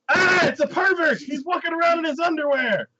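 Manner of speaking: high, shrieked alarm; hammed-up panic; mock crowd horror. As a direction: cry out like scandalized townsfolk. Shocked townsfolk, acted.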